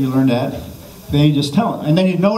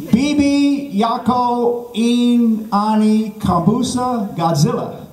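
high, drawn-out foreign phrase; mock language-lesson recitation; 7 semitones higher, much slower than usual. Shown as a demonstration. Comic teaching the crowd Swahili, slow high recitation. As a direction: intone each word slowly and high for repeating.

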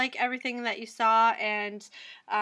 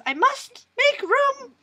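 high, loud, slow mock declaration; drawn-out, theatrical imitation of a co-host; Terry; 13 semitones higher, 6 dB louder, slower than usual. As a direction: proclaim it with hammed-up drama. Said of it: "as Terry says".

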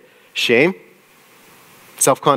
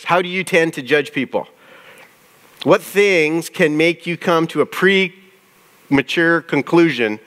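normal talking voice

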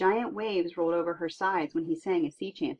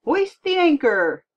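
slow, much higher and louder command; shouted order; 9 semitones higher, 10 dB louder, slower than usual. Acted. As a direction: bellow the order across the deck. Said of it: "the captain shouted".

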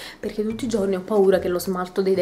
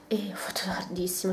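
whisper; 9 dB quieter than usual. Real, not acted. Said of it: "whispered aside".

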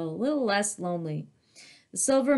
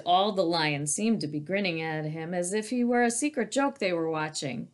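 ordinary delivery

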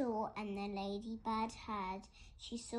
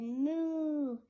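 loud, long, higher moo; duck mooing; 4 semitones higher, 7 dB louder than usual. A narrator's reading. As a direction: moo loudly, drawn out like a cow. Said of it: "the duck on the pond said".

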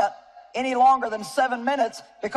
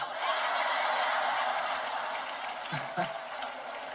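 breathy silent laughter; 7 semitones lower, 10 dB quieter than usual. Real, not acted. Preacher cracking up at husband's scripture, silent laugh. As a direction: shake with laughter without voice. Punchline about scripture quote.

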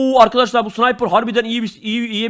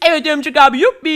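put-on high, gleeful kid voice; cheering little kid; 7 semitones higher, 3 dB louder than usual. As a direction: cheer it like a happy little boy.

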